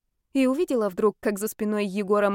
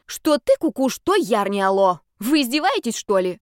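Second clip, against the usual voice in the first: exasperated; 2 semitones higher, 4 dB louder than usual. Acted. Ghost scolding friend.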